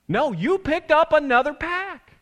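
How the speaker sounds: high, slow, emphatic retort; exasperated rebuke. Acted. Preacher answering smoker who blames God, exasperated slow rebuke. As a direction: draw it out in mock disbelief. Acted rebuke of failed quitter's excuse.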